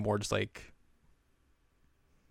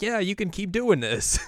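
high encouraging voice; 10 semitones higher, 3 dB louder than usual. Acted. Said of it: "The vice president was like".

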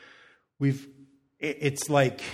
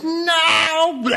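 high, loud shrieked no; mock teen shriek; 10 dB louder than usual. Mom imitating daughter refusing butter chicken, high shriek. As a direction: shriek it like a disgusted teenager. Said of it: "She's like".

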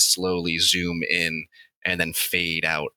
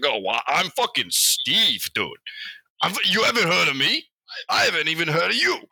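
higher, brash, macho boast; a brash higher voice, offended nobody knows him; cocky Steve; 8 semitones higher than usual. Acted. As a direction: puff up like a macho blowhard. Steve brags to the devil.

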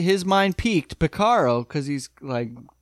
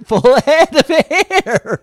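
slow laughing exclamation; 10 semitones higher, 8 dB louder, much slower than usual. Real, not acted. Laughing at Bull's bald head.

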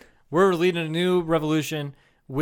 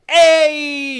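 loud, high, drawn-out "hey"; long whooping greeting; cheer of approval; 11 semitones higher, 11 dB louder than usual. Acted. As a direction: holler it in solidarity. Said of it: "I'd be like".

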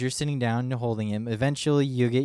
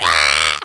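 mock baby scream; 7 semitones lower, 12 dB louder than usual.

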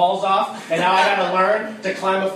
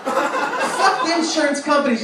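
ordinary talk, nothing marked

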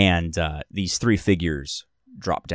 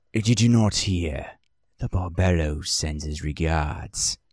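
menacing stranger; 2 semitones lower, 3 dB quieter than usual. Acted. GM voices menacing cloaked stranger.

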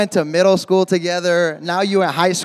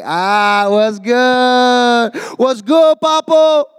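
put-on casual, higher bro greeting to an old friend; greeting the homies; 3 semitones higher, 3 dB louder, much slower than usual. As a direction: hype up your school buddy, a bit louder. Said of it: "you're like".

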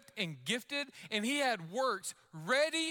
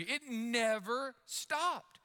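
slow emphasis; 3 semitones higher, much slower than usual. Retold or imitated. Mom describing endless energy.

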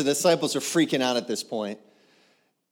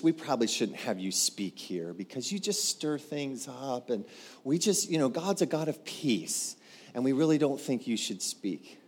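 soft, placating mediator voice; gently smooth things over, quietly; hushed, hesitant appeasing; timid peacekeeper; 4 dB quieter than usual. Acted. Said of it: "what peacekeepers want to do is".

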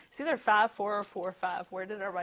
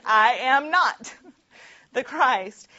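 much louder and slow, stress on not, breaking into a laugh; emphatic denial; 4 semitones higher, 11 dB louder, slower than usual. Acted. John firmly tells the priests he is not the Christ, loud and slow. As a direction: boom the denial out slowly. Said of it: "he's being very emphatic about saying".

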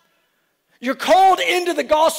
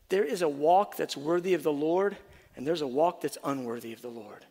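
softer, lower preaching; soft earnest teaching; 7 semitones lower, 10 dB quieter than usual. Real, not acted. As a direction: keep it hushed and sincere. Preacher continues softly.

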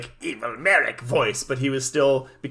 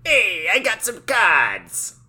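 put-on slow, higher Brooklyn wise-guy accent; 6 semitones higher, 3 dB louder, slower than usual. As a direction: drawl it like a Brooklyn card shark. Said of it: "forced to use a Brooklyn accent".

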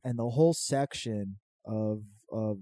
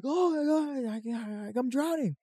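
high, slow stammering drowning wail; mock panic; 13 semitones higher, slower than usual. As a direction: stretch it out, stoned and panicking. Stoned, thinks he's drowning again.

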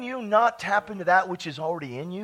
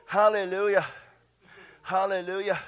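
ordinary delivery

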